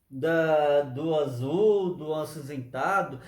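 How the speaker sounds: very slow, savouring each colour; slow listing. Real, not acted. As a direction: draw out the colours with wonder. (bem devagar)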